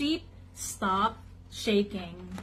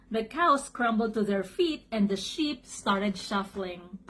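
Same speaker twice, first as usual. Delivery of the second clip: ordinary delivery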